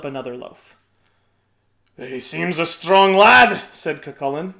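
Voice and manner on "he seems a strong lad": slow, louder giant voice; a hint of worry; lightly worried giant. A narrator's reading. Giant uneasy about the baby's strength. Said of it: "getting a little worried".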